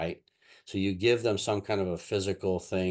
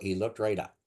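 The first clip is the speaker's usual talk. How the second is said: ordinary talk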